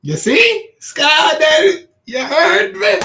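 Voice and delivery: loud, high call to the phone; shouting at the AI. Real, not acted. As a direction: yell at the machine to be heard.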